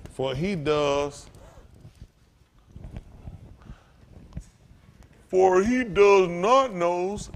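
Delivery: drawn out, very slow; slow verse reading. Shown as a demonstration. Preacher leading the church through the verse, very slow. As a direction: stretch each word for them to follow. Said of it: "reading verse slowly with congregation".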